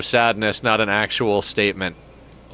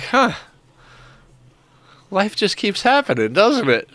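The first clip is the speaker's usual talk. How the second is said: bemused shrug; 9 semitones higher than usual. Retold or imitated. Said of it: "it's more like".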